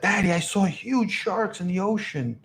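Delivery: put-on small, high kid voice; young daughter. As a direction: imitate your daughter reporting to Daddy. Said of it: "My daughter was like".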